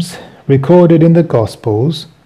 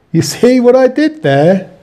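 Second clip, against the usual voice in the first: higher, teasing, rising question; pleased with own joke; 8 semitones higher than usual. Real, not acted. Preacher repeats his pun question to listeners, playfully higher. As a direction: push the pun home, grinning. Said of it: "Yeah, I thought I was clever".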